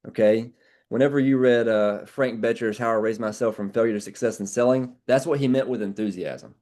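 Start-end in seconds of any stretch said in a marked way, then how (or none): none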